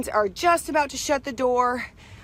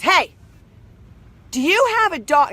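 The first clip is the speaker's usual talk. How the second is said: imitating the gate agent, higher and louder; mock stern gate agent; 7 semitones higher, 5 dB louder than usual. Gate agent confronting passenger about hidden dog, mock stern. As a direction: confront her like an official. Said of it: "she's gonna get someone".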